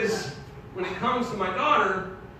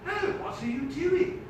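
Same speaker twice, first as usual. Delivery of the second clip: put-on high, lilting English accent; 9 semitones higher than usual. Dad posing a hypothetical to the teacher, English accent. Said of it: "that English accent also turned on that teacher".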